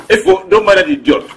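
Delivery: loud, high-pitched appeal; urgent calling out. Acted. Man calling to his partner after being insulted, urgent shout.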